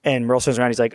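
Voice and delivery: ordinary talk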